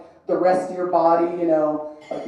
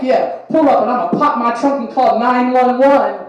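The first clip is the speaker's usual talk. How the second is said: mock bravado; 5 semitones higher, 6 dB louder than usual.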